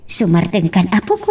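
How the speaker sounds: put-on low, lilting baby-soothing singsong; soothing hush. Grandmother rocking the crying grandchild, low soothing croon.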